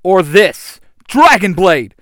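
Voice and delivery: very loud summoning yell; battle cry. Acted. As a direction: call the weapon like a war cry. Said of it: "The Red Ranger yells".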